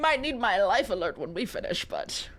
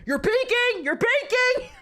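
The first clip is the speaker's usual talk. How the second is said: high, loud mock yell into a mic; mock shouting; 10 semitones higher, 5 dB louder than usual. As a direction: yell it like a panicking gamer. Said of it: "You hear in the mic".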